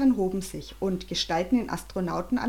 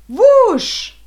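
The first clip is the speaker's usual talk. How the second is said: very loud, high whoosh; sudden shouted ignition noise; explosive whoosh; 14 semitones higher, 15 dB louder than usual. A narrator's reading. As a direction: burst out the engine's whoosh. Imitates the engine igniting.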